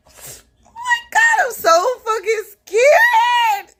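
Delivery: very high, slow, loud wail between laugh and cry; terrified shriek. Retold or imitated. Storyteller reliving stepping out of the car, high laughing-crying shriek. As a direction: let the fear burst out as a laughing scream.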